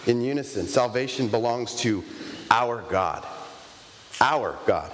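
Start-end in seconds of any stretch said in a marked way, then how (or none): 0.7-3.2 lightly voiced Texan drawl on our God; a hint of Texas twang saying our God; mock Texan. Preacher imagines Texans saying it, mild Texas drawl. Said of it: "Texans saying this". 4.2-4.9 lightly voiced Texan our God repeated; a touch of Texas twang on Our God; mock Texan. Preacher echoes the Texans in a mild Texas drawl. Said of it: "Texans saying this".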